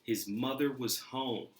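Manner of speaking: ordinary delivery, nothing marked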